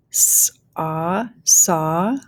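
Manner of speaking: "saw" built from a held s and ah; teacher blending "saw" slowly; phonics blending. Shown as a demonstration. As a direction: sound out s, ah, then join as "saw". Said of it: "go ahead and start blending".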